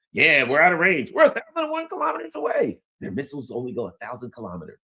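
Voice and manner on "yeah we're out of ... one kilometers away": high, loud, rushed boast; mock cockiness. French navy thinks it is out of range, mock glee. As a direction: crow it faster and higher as it goes. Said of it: "The French will be like".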